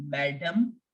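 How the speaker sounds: madam said the English way, stress on the first syllable; English madam, short unstressed ending; English pronunciation. Shown as a demonstration. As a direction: say madam as MAD-um. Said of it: "as English".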